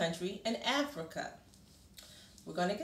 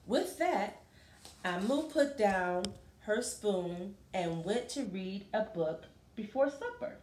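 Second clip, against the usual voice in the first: lively, sing-song picture book reading; reading to children. Acted. Teacher reading a picture book to a class, lively.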